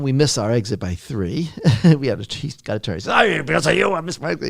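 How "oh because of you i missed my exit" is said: higher-pitched mock angry outburst; mad. Coach blaming the interviewer for the missed turn, mad. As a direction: imitate a coach blaming his passenger. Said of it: "He was so mad".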